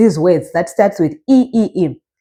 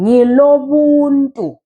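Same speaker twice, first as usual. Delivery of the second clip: "ngilo buntu" slow, higher, drawn out; slow teacher's demo of "ngilo buntu"; model pronunciation; 5 semitones higher, slower than usual. Shown as a demonstration. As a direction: stretch "ngilo buntu" with raised pitch. Teacher models phrase for learners.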